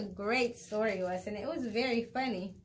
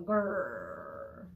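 tiger growl; 8 semitones lower than usual. Acted.

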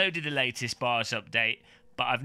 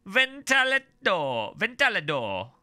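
high, slow mock-Italian reading; Super Mario Italian accent; 7 semitones higher, 3 dB louder, slower than usual. Vlogger reading foreign PC manual aloud for laughs, mock-Italian accent. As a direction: put on a hammy Italian accent, drawing the words out. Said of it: "you gotta read it like Super Mario".

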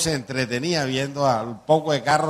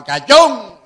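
high, loud taunt yelled from the street; loud schoolboy jeer; shouted insult; 9 semitones higher, 11 dB louder than usual. Retold or imitated. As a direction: bellow the insult like a mocking kid. Kids shouting insult at guard.

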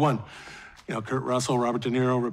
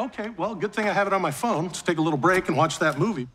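usual speaking voice

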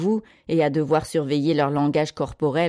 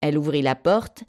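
ordinary delivery, nothing marked

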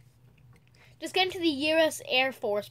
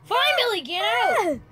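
high, loud exasperated yell; 9 semitones higher, 7 dB louder than usual. Real, not acted. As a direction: yell him out of the car. Exasperated at screaming Billy.

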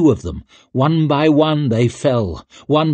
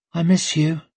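dazed woman; 6 semitones higher than usual. Acted. Dazed Rikke speaking to absent lovers.

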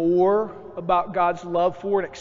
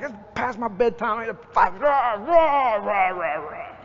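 put-on childish grumbling; 4 semitones higher than usual. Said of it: "I'm mad 'cause".

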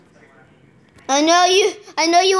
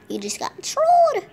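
high gloating taunt; 13 semitones higher than usual. Real, not acted. Taunting Matt plays in game, high gloating. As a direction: rub it in.

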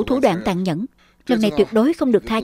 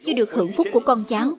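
ritual incantation. Acted. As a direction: intone it like a funeral priest. Funeral rite incantation.

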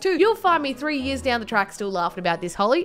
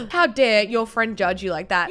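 usual speaking voice

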